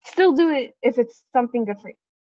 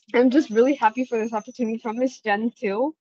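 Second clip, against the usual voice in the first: usual speaking voice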